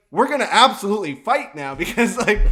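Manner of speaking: loud, laughing half-serious threat; laughing mock threat. Telling the kid he'll get a fight, loud and laughing.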